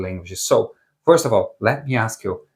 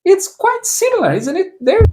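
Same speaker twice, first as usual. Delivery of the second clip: much higher, playful squeak; teasing high voice; 3 dB louder than usual. Acted. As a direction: tease the learner in a high voice. Teasing the learner.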